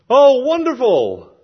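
high, loud, drawn-out gush; mock-impressed exclamation; delighted. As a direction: gush it slowly, acting hugely impressed. Acts out a delighted listener's reply.

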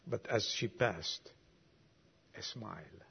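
a hushed, fading turn in the poem. Acted. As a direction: let the line die away tenderly. Poem's tender turn, voice sinks.

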